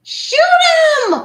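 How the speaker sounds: shouting. Pretend. Shouting to allies.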